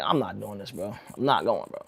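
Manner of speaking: low, hushed private refusal; retold muttering to oneself; inner thought. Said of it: "already in my mind like".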